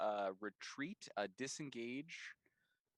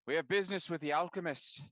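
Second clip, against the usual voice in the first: raised, higher voice calling out in character; loud, pitched-up announcement; shouting; 6 semitones higher, 5 dB louder than usual. Acted. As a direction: shout your business across to them. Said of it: "I'll try to shout".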